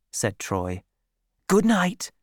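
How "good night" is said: lightly voiced sergeant, higher goodnight; a hint of a soldier dismissing someone; soldier's farewell. A narrator's reading. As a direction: bid a brisk goodnight.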